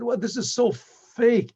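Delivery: slow, high, scornful outburst; scornful anger. Retold or imitated. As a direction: spit out the word fake in disgust. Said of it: "What are you so angry about?".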